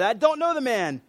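half-shouted, emphatic retelling of a scream; mild mock scream. Peter cussing as he denies Jesus, mild mock yell. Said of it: "as he's cussing and screaming".

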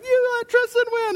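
very high, mock child whine; protesting little kid. Older son to dad after losing the race, mock high-pitched protest. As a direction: squeal it like an outraged little boy.

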